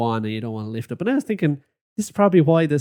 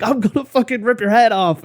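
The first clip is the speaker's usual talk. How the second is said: retold shouting threat; 7 semitones higher than usual. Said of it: "Everyone can hear you".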